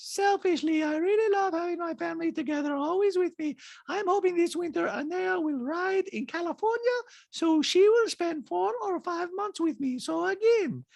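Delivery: put-on very high-pitched accented caricature; squeaky falsetto mimicry of a reality star; Diana impression. Host mimics reality star in recap, high-pitched caricature. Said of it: "Diana, the talking head, goes".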